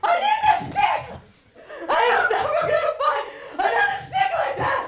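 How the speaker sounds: mother imitating her son's meltdown over a stick; wailing little boy. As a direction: sob out the loss of the best stick ever. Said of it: "he's just like".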